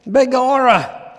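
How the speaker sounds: hammed-up Irish pub caller declaring war; attempted Irish accent. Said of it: "needs an Irish accent".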